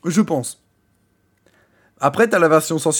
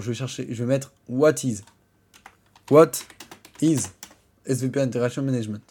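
normal talking voice

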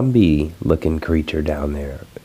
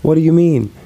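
mock viewer objection; 8 semitones higher than usual. Vlogger imitating a viewer who complains about the missing second video, higher and whiny. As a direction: play the smug viewer catching him out. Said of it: "you're like".

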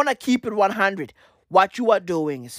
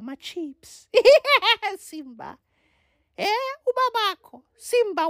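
high-pitched cackling hyena taunting Simba; Lion King hyena; 14 semitones higher than usual. Acted. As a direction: squeal and cackle like a cartoon hyena. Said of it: "Legit, I be out like".

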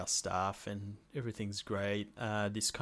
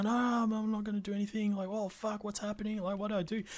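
mock panic; 10 semitones higher than usual. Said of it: "and I was like".

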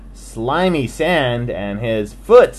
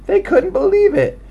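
excited; 5 dB louder, faster than usual. A narrator's reading. Excited narration.

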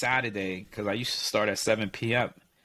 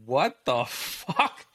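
slow, laughing what the fuck; laughing disbelief; much slower than usual. Retold or imitated. Soldier hearing his crew came to play, laughing disbelief. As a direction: drag it out through a laugh in disbelief. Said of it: "And I was like".

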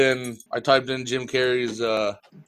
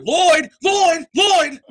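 high, loud repeated movie yell; Jim Carrey; 6 dB louder, much slower than usual. Acted. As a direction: holler the name like a comic film character. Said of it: "Dumb and Dumber Jim Carrey".

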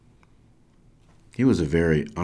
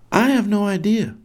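high-pitched raised voice; yelling back; 14 semitones higher, 4 dB louder than usual. Acted. Boy answering from the island, yelling back. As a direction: call back from far away. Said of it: "Kraft yells back".

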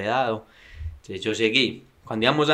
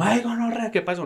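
much higher, startled cry; 11 semitones higher than usual. Retold or imitated. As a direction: squeak out the shock of being rear-ended. Startled after being hit. (sorpresa aguda y asustada)

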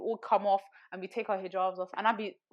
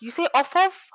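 higher, louder mock disbelief; incredulous question; 9 semitones higher, 8 dB louder than usual. Professor querying her English correction, incredulous. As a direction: imitate a baffled teacher querying a phrase.

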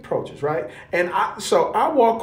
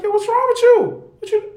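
high, loud exasperated call to a friend; mock-baffled, pitch way up; what's wrong with you, man; incredulous yo; 5 dB louder, faster than usual. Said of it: "I'm like".

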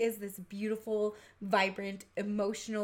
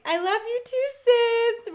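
high sing-song mock sweetness, louder; taunting sweet reply; 13 semitones higher, 8 dB louder, slower than usual. Sister mocking her sister through the bathroom door, sing-song. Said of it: "And I'm just like".

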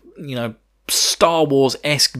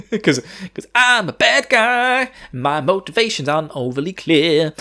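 mock bad guy; 6 semitones higher than usual. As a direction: draw it out high, then settle, as a parody villain. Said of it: "And he's like".

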